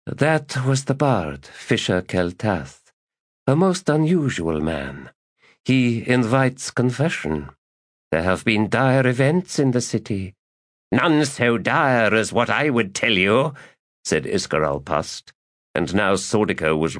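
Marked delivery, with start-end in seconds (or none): 0.0-10.3 grave lady. Acted. Lady slowly recounting the bard. 10.9-13.5 lightly voiced smug magus; smug boast.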